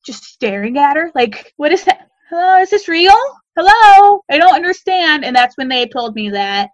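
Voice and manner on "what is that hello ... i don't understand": high, bewildered questioning; retold stunned disbelief. Stunned woman seeing her sister on screen, retold stunned disbelief. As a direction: act out baffled disbelief, pitch raised.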